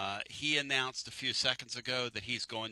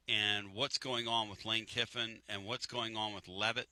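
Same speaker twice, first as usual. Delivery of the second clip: ordinary talk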